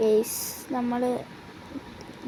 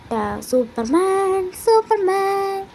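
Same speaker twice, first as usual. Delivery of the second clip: slow, then much higher and louder heroic call; mock superhero cry; 7 semitones higher, 3 dB louder, much slower than usual. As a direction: hail yourself as Superman. Hero cry after gaining power. (സൂപ്പർമാനെപ്പോലെ ആവേശത്തോടെ വിളിച്ചുപറയൽ)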